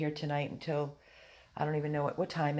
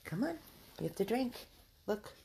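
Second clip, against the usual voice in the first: high coaxing; 6 semitones higher, 3 dB quieter than usual. Real, not acted. Coaxing dog to drink.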